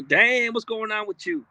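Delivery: usual speaking voice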